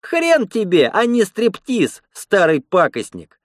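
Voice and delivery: high, slow spiteful taunt; gloating. A narrator's reading. Lena taunting the peeping old attendant, gloating. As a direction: stretch out the taunt with malicious glee. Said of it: "злорадно сказала Лена".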